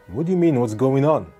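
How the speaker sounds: normal talking voice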